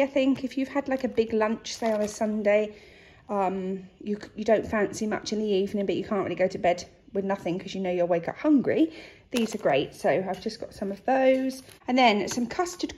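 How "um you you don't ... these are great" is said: usual speaking voice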